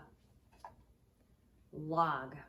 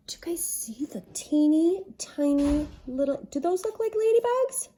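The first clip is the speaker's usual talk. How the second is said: excited wonder; 8 semitones higher, 9 dB louder than usual. Real, not acted. Teacher asking children about the teeny contents, excited and high. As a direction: marvel loudly at something tiny, pitch climbing.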